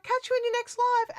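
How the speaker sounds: high, loud mock-chirpy goodbye; exaggerated bubbly sign-off; cheery farewell. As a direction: call it out high, imitating an eager fan.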